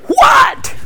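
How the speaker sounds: very high, snapped-back "What?"; teen's annoyed what. Retold or imitated. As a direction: bark back like an irritated kid. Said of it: "I answered".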